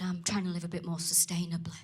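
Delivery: low hushed whisper; confiding whisper. Retold or imitated. Imitating a woman whispering her eco confession. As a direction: lean in and whisper it like a secret.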